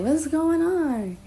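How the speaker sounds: high, slow, worried roommate question; lightly voiced concerned roommate; roommate asking. Roommate opening the door, high slow worried question. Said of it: "she opened the door like".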